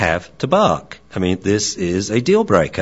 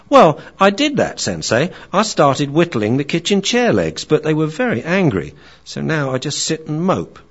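higher, eager then forlorn puppy; young dog voice; 7 semitones higher than usual. Acted. Young dog tells Theo about being left alone, higher. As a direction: chatter high like a lonely young dog. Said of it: "he's dealing with his wise friend".

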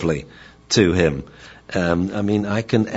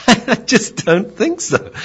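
slow, very high dog voice through a laugh; laughing refusal; 4 dB louder, slower than usual. Acted. Dog declining the yelling owner, high laughing refusal.

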